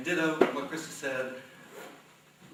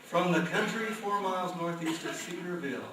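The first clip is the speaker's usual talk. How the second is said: raised pitch, drawing out the name; announcer introduction; 4 semitones higher than usual. Real, not acted. Host brings on the first poet, announcer style.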